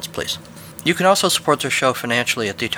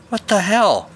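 stunned, pitched up high; shocked exclamation; 8 semitones higher, 3 dB louder, slower than usual. Acted. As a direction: blurt it out in disbelief. Boys shocked by arrest.

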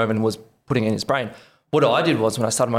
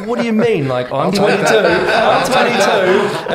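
incredulous outburst; 9 semitones higher, 7 dB louder, slower than usual. Retold or imitated. Retold disbelief at mum.

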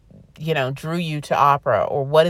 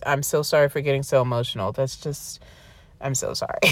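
low, hushed and emotional; emotional apology; 5 semitones lower, 4 dB quieter, faster than usual. Real, not acted. Podcaster apologising to listeners for getting emotional, subdued. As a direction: apologise softly, trailing into near silence.

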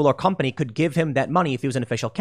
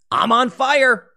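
higher, louder mock cry of the burning bull; raging bull; 7 semitones higher, 4 dB louder, slower than usual. Bull engulfed in flames cries out, higher and louder.

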